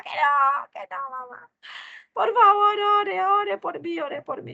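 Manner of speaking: put-on high child's voice, begging; pleading child. Daughter begs her mother to pray instead. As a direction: squeak out a child's plea. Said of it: "le dije".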